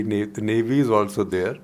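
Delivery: ordinary delivery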